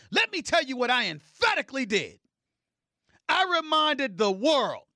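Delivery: loud, high, forceful proclamation; emphatic declaration. Real, not acted. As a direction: ring it out to the whole world. Emphatic retort to accusers.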